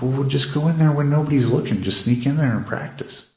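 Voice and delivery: coaxing her son like a fellow plotter; playful conspiratorial coaxing. Retold or imitated. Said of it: "and I'm like".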